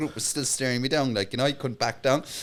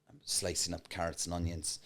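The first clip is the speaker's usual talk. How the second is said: ordinary delivery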